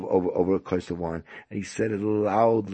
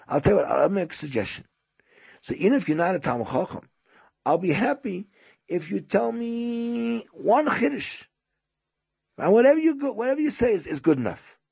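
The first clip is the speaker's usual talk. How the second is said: high, eager coaxing; coaxing host; 11 semitones higher, 3 dB louder than usual. Retold or imitated. Host asks guest for one Chiddush, coaxing. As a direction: offer an easy bargain in a raised pitch.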